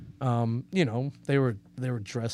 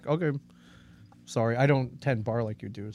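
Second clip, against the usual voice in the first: normal talking voice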